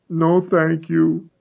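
slow high small reply; small numb voice. Acted. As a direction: answer numbly and slowly. Dazed boy refuses.

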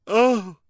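high, loud pained Oh; anguished cry. Acted. Lulu crying out at hearing her name. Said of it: "Madame Lulu cried".

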